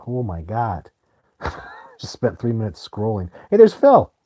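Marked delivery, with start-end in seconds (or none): 3.5-4.1 high, loud call out to a friend; happy to see a friend join the stream; excited greeting. Real, not acted. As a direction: greet him like he just walked in. Spotting a friend in chat.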